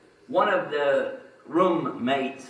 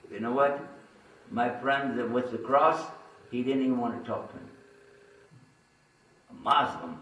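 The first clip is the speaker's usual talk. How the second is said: usual speaking voice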